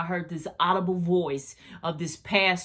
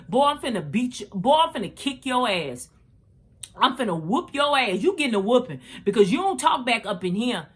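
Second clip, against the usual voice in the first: mock angry mama threat; 5 semitones higher than usual.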